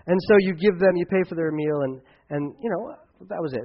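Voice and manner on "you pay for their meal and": normal talking voice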